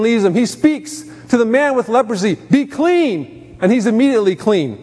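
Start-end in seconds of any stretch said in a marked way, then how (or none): 2.5-3.6 higher, firm command. Acted. Preacher voicing Jesus healing the leper, higher and commanding. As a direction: declare it with authority.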